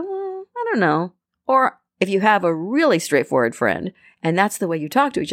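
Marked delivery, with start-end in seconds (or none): 0.0-1.1 doubtful hedge. Retold or imitated. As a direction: hedge it so she gets the hint. Hesitant friend shopping quote.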